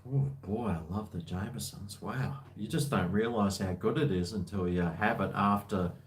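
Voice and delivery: normal talking voice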